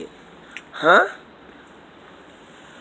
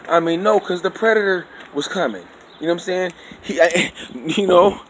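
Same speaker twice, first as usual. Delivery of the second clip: ordinary talk, nothing marked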